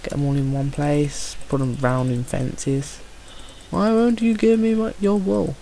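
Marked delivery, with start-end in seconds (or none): 3.7-5.6 higher whining question to the sheep; whiny plea. Real, not acted. Gamer whining at a sheep to give him wool. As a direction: whine at the sheep.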